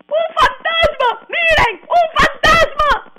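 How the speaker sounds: high, loud, slow panicked cry of a ghost sighting; terrified shout. Retold or imitated. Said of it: "Empezaron todos a gritar del susto".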